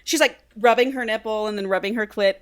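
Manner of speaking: usual speaking voice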